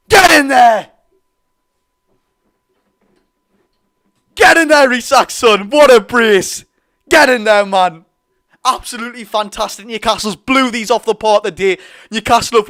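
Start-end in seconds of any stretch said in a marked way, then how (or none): 0.1-8.0 loud chant-like shout; celebratory roar. Real, not acted. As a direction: keep bellowing in triumph. Fan repeats celebratory roar.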